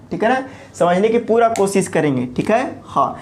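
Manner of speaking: ordinary talk, nothing marked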